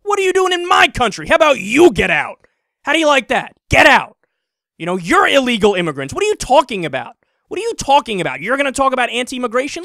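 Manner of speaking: retold angry yelling, loud and high; furious rant. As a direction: shout him down like the furious protester. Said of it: "this guy was furious".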